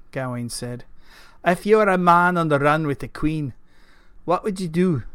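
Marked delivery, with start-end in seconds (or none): 1.4-5.0 burly Scottish knight's gruff voice; gruff Scotsman. A narrator's reading. Gawain asks Keith about tracking, gruff Scottish voice. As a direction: play a gruff Scotsman sounding out his companion. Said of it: "gruff voice that matched his exterior".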